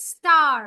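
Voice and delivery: কার shouted with a long a and strong r; stressed r sound. Shown as a demonstration. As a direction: belt out কার so the r rings. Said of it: "এখানেও আরটা". (আর-ধ্বনি জোর দিয়ে, উচ্চস্বরে)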